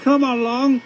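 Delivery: puffing engine. A narrator's reading. James urging the trucks, high and puffing. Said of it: "he puffed".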